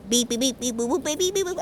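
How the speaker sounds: robotic beeps and boops; mock gibberish. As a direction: imitate garbled alien speech in beeps. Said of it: "our language got cut out".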